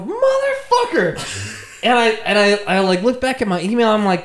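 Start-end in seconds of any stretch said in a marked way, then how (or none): 0.0-1.8 high, slow, stretched-out cursing with a laugh; drawn-out outraged swear. Retold or imitated. Storyteller reliving seeing the tweet, drawn-out comic outrage.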